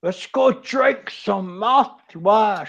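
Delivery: loud, high accent with S turned to sh; speaker's own accent, exaggerated. Shown as a demonstration. Showing friends how to talk like me, thick accent with sh for S. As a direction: lay the accent on thick, hushing every S. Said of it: "My accent".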